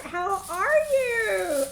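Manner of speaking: high, loud, stretched-out welcome; excited greeting. Real, not acted. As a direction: greet them with real gusto, drawing it out. Greeting another arriving viewer.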